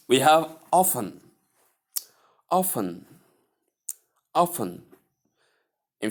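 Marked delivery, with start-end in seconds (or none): 0.7-4.8 "often" as OFF-un, silent T; T left out. Shown as a demonstration. Teacher introduces word number six, often with silent T. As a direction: say "often" without any T sound. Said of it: "omitting the T".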